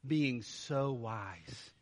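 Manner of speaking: slow, soft, dripping sarcasm on so wise; drawn-out sarcastic jab. Shown as a demonstration. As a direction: drag out being so wise mockingly. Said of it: "do you understand the sarcasm".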